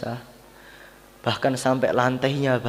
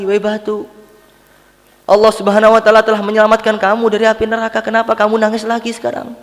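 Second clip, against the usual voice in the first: high, loud, rapid challenge; insistent questioning; 10 semitones higher, 8 dB louder, faster than usual. Retold or imitated. Prophet questions the stone still crying, urgent and loud. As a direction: fire the question off in a raised, urgent voice.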